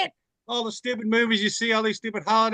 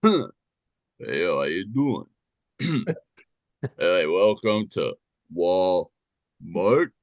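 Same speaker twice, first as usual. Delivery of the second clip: much lower, slow Stallone mumble; much lower, slow Sylvester Stallone; Stallone impression; 11 semitones lower, much slower than usual. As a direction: greet shoppers as Stallone. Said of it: "best one would be Sylvester Stallone".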